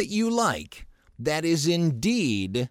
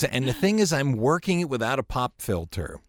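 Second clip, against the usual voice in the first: ordinary talk, nothing marked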